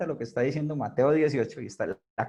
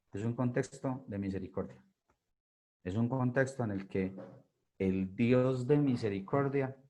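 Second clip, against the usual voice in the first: soft exhortation; 3 semitones lower, 6 dB quieter than usual. Real, not acted. Closing exhortation, softened.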